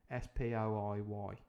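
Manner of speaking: plain reading voice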